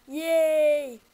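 loud high happy yell; excited cheer. Real, not acted. Cheering in game.

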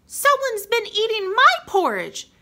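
put-on high little baby bear voice. A narrator's reading. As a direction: squeak it out like a tiny cub. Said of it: "Baby Bear said".

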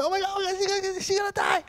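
terrified moviegoer squealing at the screen; panicked viewer. Retold or imitated. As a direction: squeal it like a terrified first-timer. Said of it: "and you go".

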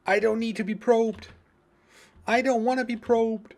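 high, loud panicky yelling; panicked shout. Pretend. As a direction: shriek at them to go.

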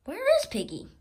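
a startled worried call; sudden alarm. Acted. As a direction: gasp it in sudden worry.

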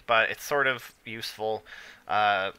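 ordinary talk

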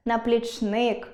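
наплічник as наплічНИК; наплічник with the final syllable stressed; stress on last syllable. Shown as a demonstration. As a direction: lean slowly on НИК in наплічник. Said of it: "спробуйте вимовити голосніше кожен з них".

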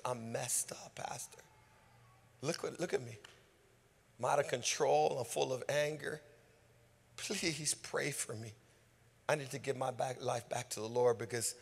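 soft, hushed repentant voice; whispered confession. Retold or imitated. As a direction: whisper it like a broken man.

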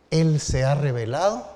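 ordinary delivery

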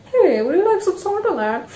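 high, loud, bad British butler accent. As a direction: ham up a terrible British butler. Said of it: "such a bad British accent".

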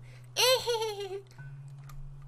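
squealed, drawn-out giggle; high giggle. Pretend. Player playing around the bedroom, high giggling.

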